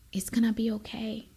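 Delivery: soft, breathy whisper of reassurance; whispered comfort. Real, not acted. Whispering comfort to listeners still waiting. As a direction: breathe it to them gently.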